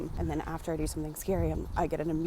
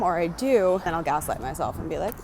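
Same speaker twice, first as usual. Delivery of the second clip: normal talking voice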